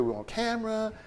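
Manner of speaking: high, soft acted complaint; voicing hurt feelings. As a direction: play someone gently confessing they were hurt. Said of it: "you hurt my feelings".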